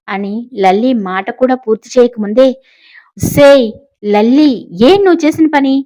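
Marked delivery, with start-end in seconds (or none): 3.2-5.7 a sudden loud, high yell at the daughter; shout. A narrator's reading. Mother yelling at Lalli over the new dress, loud and high. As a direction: shout her name to make her jump. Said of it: "ఒక్క అరుపు అరిచింది కమల".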